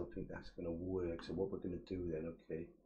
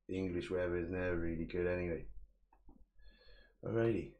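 ordinary delivery

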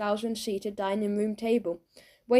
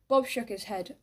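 plain reading voice

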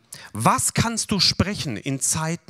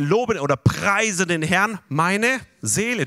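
slow recitation; 3 semitones higher, slower than usual. Shown as a demonstration. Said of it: "Dann sagen wir".

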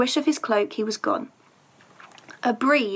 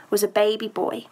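plain reading voice

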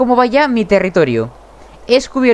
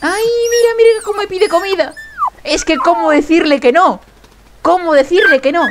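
doting coo; 7 semitones higher than usual. Real, not acted. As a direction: give in to a begging pup. Pup begs him for food.

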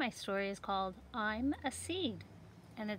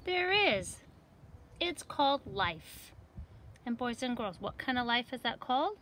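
lively picture book reading; reading to children; 3 semitones higher than usual. Acted. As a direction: read it brightly for young kids. Seeds close the story.

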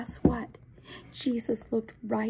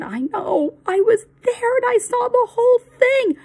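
much higher and louder, rushing it out; urgent excitement; 11 semitones higher, 9 dB louder than usual. Acted. Benjamin tells Eli he saw the arrest, high and urgent. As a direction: blurt out what you saw.

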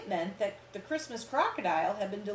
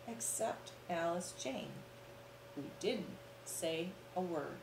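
hushed; 3 semitones lower, 8 dB quieter than usual. A narrator's reading. Storyteller hushes for suspense.

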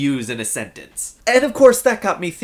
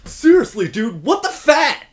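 mock exasperation; 7 semitones higher, 4 dB louder than usual. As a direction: pretend to swear at a friend. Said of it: "I can see myself actually saying that".

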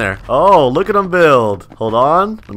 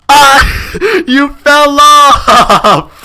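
high, loud laughing squeal; laughing shriek; 11 semitones higher, 7 dB louder, much slower than usual. Real, not acted. Streamer laughing as rival falls off, high squeal. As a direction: crack up as he falls.

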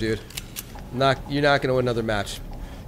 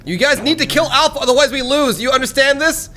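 urgent shouted orders; 10 semitones higher, 3 dB louder than usual. Real, not acted.

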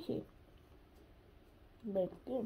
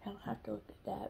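whispering; 11 dB quieter, much faster than usual. Real, not acted. Laughing whisper.

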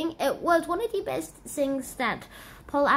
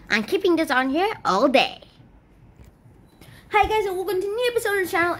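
normal talking voice

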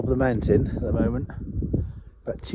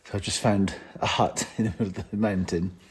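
ordinary delivery

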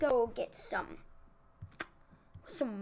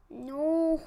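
loud, higher cry of dismay; 4 semitones higher, 9 dB louder than usual. Real, not acted. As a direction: wail it out loud. Dismayed at game setback.

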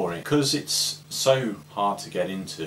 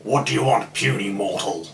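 Hector the Prog God; 6 dB louder than usual. Pretend. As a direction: thunder down at a puny mortal. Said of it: "Hector the Prog God".

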